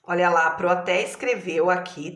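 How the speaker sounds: ordinary talk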